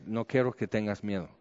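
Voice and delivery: soft near-tears. Retold or imitated. Recalling God's words while he was crying, hushed and soft. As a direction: let it fall to almost nothing. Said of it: "Estaba llorando".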